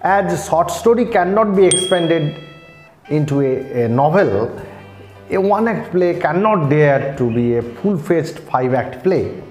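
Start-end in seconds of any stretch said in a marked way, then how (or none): none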